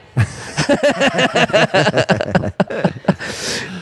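big laugh at his own joke; hearty laugh. Real, not acted.

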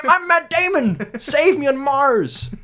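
high, slow hammed-up wail; mock yell. Co-host parodies Damon begging, slow mock yell. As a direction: drag it out like a self-pitying star. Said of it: "What he yells".

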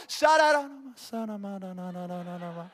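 low, soft run of repeated syllables; praying in tongues. Retold or imitated. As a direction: imitate tongues under your breath, trailing on and on. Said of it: "pray in tongues".

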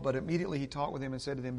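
very fast, rushed reading; auctioneer. Shown as a demonstration. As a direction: race through the words. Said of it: "I'm like an auctioneer almost going through this".

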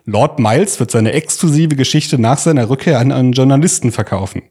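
normal talking voice

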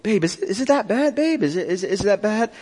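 mock dismissive husband; minimizing. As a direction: play down the upset in a lifted, questioning tone. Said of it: "The third mode is minimizing mode".

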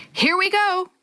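high, held call without real volume; storybook shout, pitched well up; excited shout. A narrator's reading. Boy grips the handles as rapids near, high contained shout.

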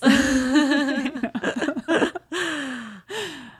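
high, bright burst of laughter; long falling laugh; real laugh. Real, not acted. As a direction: laugh it off, amused at the memory. Laughs at own story.

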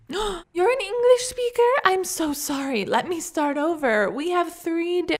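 high, loud, gushing apology; effusive, effete waiter falling over himself; trilling waiter. A narrator's reading. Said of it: "he trilled".

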